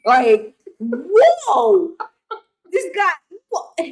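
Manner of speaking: high, surprised mock turtle. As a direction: voice a startled turtle. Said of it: "he would be like".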